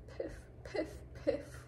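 tiny, high, soft whimpers; little pretend sniffles, one by one; mock whimpering. A narrator's reading. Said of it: "whimpered the fox".

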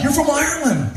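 high, incredulous question; surprised question. Retold or imitated. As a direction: act amazed at the answer. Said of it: "I'm like".

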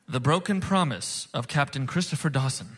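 ordinary talk, nothing marked

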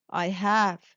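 ordinary delivery, nothing marked

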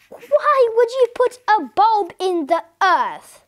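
slow, high, baffled question; incredulous. A narrator's reading. Wife baffled by her husband's gardening answer, slow incredulous question. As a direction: spell out the question slowly in high disbelief.

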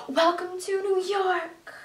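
slow, drawn-out stage announcement; a hint of a pop star greeting the crowd; lightly imitated concert intro. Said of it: "[name] comes and's like".

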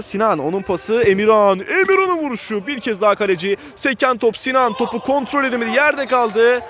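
excited commentator. Real, not acted. Big chance, saves in the box.

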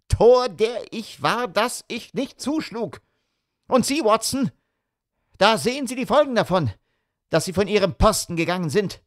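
high bitter outburst; self-reproach, angry. A narrator's reading. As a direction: berate himself, then Watson, quickly. Holmes wirft sich Versäumnis vor.

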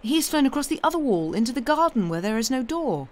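put-on high, eager girlish exclamation; excited cry. A narrator's reading. Girl following the robin's flight over the walls, excited cry. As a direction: call out each discovery excitedly. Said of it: "Mary cried out".

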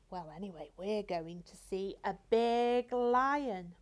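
ordinary talk

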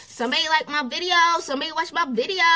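high, fast and frantic, hungry for attention; voice of the ego. Retold or imitated. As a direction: play the needy ego scrambling for notifications. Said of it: "The ego is like".

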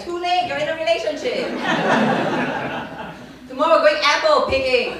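spring the trap on him loudly; gleeful mock threat. She tells her date he's taken, loud and gleeful. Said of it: "I was like".

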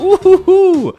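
high, loud "ooh-hoo-hoo"; delighted whoop. Real, not acted. Gamer showing viewers the tank camo, delighted whoop.

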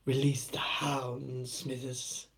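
slow, soft, lightly voiced Mr Burns; a hint of Mr Burns, drawn out; Mr Burns impression. Asking Smithers to release the hounds, lightly voiced old villain. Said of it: "my impression of, uh, Mister Burns".

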